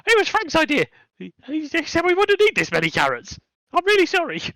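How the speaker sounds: squeaky high panicked kobold voice; a terrified little thief babbling apologies; blubbering kobold. Acted. Kobold caught stealing carrots, panicked high squeak. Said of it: "they just blubber out something along the lines of".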